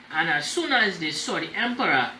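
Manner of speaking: ordinary delivery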